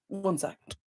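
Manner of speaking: throat rough from dust; hoarse after coughing. Real, not acted. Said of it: "the dust is getting to me".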